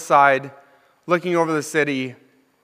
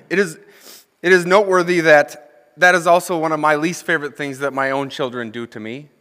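usual speaking voice

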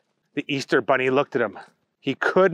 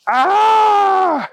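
loud, high sustained scream; yell of pain; 8 dB louder than usual. A narrator's reading. Bunny as the car reverses onto him, loud high yell. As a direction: cry out as a paw gets crushed. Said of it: "yelled the bunny".

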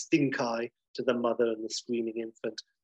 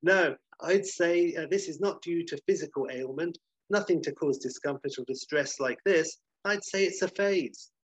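doctor; 5 semitones higher than usual. Acted. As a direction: pronounce the diagnosis in a higher doctor's voice. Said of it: "And the doctor says".

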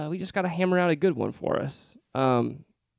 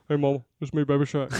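[name] impression. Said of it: "it's just like his voice".

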